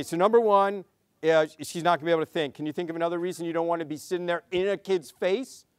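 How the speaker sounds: ordinary talk, nothing marked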